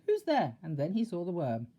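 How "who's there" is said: high, loud alarmed shout; startled shout. A narrator's reading. Mister Jelly startled by the worm, high loud shout. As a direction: call out in fright. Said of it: "he shouted".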